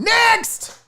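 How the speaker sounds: high, sharp call to move on; abrupt high-pitched dismissal; dismissive call. Real, not acted. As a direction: shout it high, rejecting it outright. Dismisses the Dallas restaurant.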